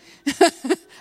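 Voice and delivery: big burst of laughter, high and loud. Real, not acted. Telling the women about the office ladies, a loud high laugh. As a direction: laugh out hard at the punchline.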